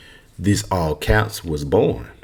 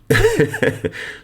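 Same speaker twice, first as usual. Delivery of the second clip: laugh. Real, not acted. Laughs at own slip.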